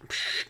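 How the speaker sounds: short soft radio hiss made by mouth; imitated radio static. Host playing mission control, imitated radio static. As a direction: psst of a walkie-talkie cutting out.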